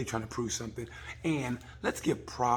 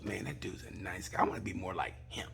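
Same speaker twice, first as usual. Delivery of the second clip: soft whisper, lower; hushed Jesus voice; 5 semitones lower, 7 dB quieter than usual. Retold or imitated. Jesus admiring the nicest rider, in a soft whisper. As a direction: whisper it with awe.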